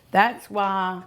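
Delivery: normal talking voice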